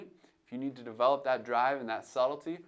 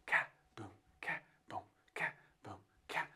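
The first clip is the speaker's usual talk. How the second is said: whispered boom-ka, imitating a kick and snare; vocal drum beat imitation; 2 semitones higher, 7 dB quieter, faster than usual. Shown as a demonstration. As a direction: mouth the beat softly like drums. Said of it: "It's kinda like if I went boom".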